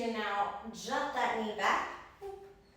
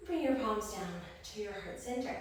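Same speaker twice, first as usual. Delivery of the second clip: normal talking voice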